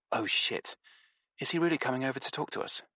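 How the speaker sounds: hushed, quick dismayed mutter. Acted. Nevada to narrator as Stinky nears, hushed quick dismay. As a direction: groan it under the breath, fast.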